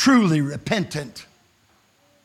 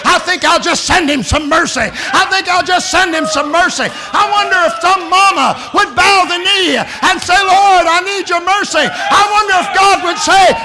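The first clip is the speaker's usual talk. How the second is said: loud, higher, building preacher's cry; preaching at full force; 12 semitones higher, 8 dB louder than usual. Acted. Preacher voicing God's joy to the camp meeting. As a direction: build it to a ringing climax.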